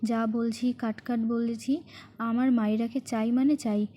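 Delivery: ordinary delivery, nothing marked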